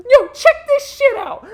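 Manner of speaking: high, loud hyped yell; hyped stunt woman. Retold or imitated. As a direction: shout like a show-off stuntwoman. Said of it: "she'd be like a stunt woman".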